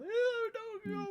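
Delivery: high-pitched, hammed-up wail; mock ugly crying. Game master playing Jade crying over the goodbye, ugly crying. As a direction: blubber it out in a comically exaggerated sob. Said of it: "ugly crying".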